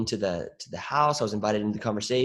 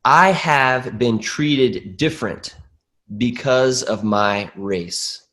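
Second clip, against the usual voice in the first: slow, deliberate read-aloud, louder; formal statement reading; 7 dB louder, much slower than usual. Real, not acted. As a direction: announce it slowly to the whole group. Host reads statement aloud to group.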